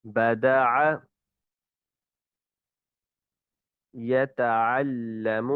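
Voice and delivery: slow, ayn let out freely from the throat; correct ayn. Shown as a demonstration. Teacher models the ayn word list, slow and clear. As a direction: recite each ayn word slowly and openly. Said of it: "صحیح تلفظ کے ساتھ ادائیگی کریں گے".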